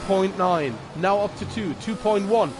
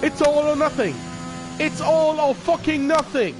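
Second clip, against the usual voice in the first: motorsport commentator; 9 semitones higher than usual. Real, not acted. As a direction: call the final lap at full energy. Final lap commentary, high energy.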